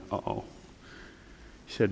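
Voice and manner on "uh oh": soft worried uh-oh. Retold or imitated. Bracing for bad news.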